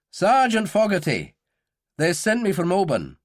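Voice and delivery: brisk, dutiful sergeant; reporting sergeant. A narrator's reading. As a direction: give name and rank to an officer. Sergeant introduces himself to officer.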